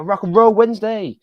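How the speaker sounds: loud, high announcer call; hyped show announcement. Acted. Host announcing theme night to the livestream, hyped shout.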